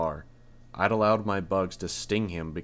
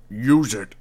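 much louder roaring command; 3 semitones higher, 10 dB louder than usual. A narrator's reading. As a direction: roar it at her. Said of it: "The mayor's voice was a roar".

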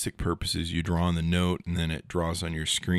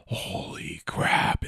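slow whispered awe; drawn-out whisper of disbelief; whispered amazement; much slower than usual. Acted. Vlogger reacts to two-way wireless charging, in an amazed whisper.

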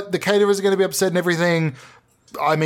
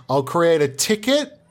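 usual speaking voice

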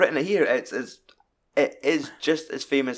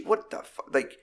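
acted-out, appalled squawk of disbelief; incredulous outrage; 9 semitones higher, 4 dB louder than usual. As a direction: react as if you can't believe it. Outraged reaction to a film scene.

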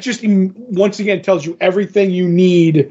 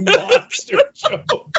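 laughing; much slower than usual. Real, not acted. Teasing co-host over gross avatar.

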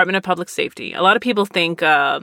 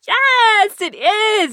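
excited yes; much slower than usual. Retold or imitated. Clerk thrilled about her fandom.

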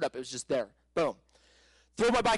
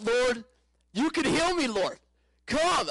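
high, urgent retold prayer call; crying out to God; 8 semitones higher, 4 dB louder, slower than usual. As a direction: cry out to the Lord for healing, pitched high. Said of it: "crying out in the bed".

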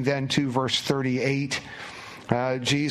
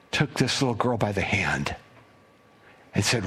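whispered storytelling; hushed narration; 5 semitones lower than usual. A narrator's reading. Preacher whispers to the congregation at the climax. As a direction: drop to a whisper, draw them in.

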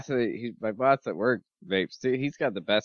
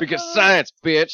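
high, loud triumphant cry; meme exclamation; 9 semitones higher, 9 dB louder than usual. Acted. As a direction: blurt it like a catchphrase. Quoting meme line with gusto.

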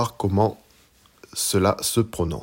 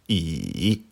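I dipping low then climbing back up; third tone on I; 3 semitones lower than usual. Shown as a demonstration. Teacher showing learners Mandarin I, dipping tone. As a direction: draw I down and up again. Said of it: "Je les répète".

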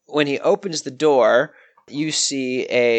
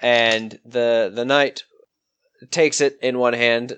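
usual speaking voice

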